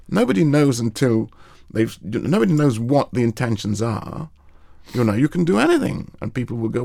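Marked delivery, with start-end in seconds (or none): none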